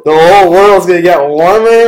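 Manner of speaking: loud, high, mock-thrilled; hammed-up excited exclamation; naive excitement. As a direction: act out a kid's delight at great news. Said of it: "I thought it's great".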